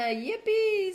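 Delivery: high, drawn-out yippee; playful high-pitched cheer; mock cheer. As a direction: cheer it out high and loud, reading for a viewer. Reads out a viewer's cheer.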